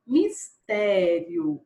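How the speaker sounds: "Mistério" drawn out very slowly and evenly; slow word dictation. Shown as a demonstration. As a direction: stretch "Mistério" for the class to hear each sound. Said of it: "eu vou separar". ("Mistério" bem devagar, alongando as sílabas)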